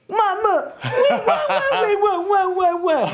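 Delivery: put-on squealing high baby voice with laughter. Hosts mimic a baby on the show, high squeal. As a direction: squeak it out like a toddler, giggling.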